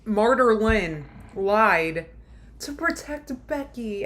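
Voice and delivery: mock her noble excuse, dripping sarcasm; sarcastic. Real, not acted.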